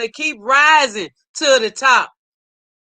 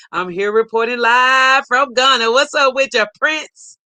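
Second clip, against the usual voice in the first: ordinary talk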